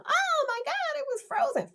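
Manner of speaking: put-on very high, thrilled child voice; excited kid. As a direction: squeal it like an overjoyed kid. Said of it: "And they go".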